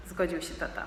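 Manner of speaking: ordinary delivery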